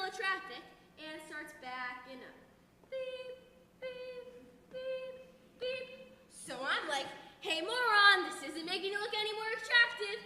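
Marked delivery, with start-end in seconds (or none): 2.9-6.2 imitated reversing beeps. Car reversing through traffic. 7.4-10.2 mock angry yell. Yelling at the catcaller.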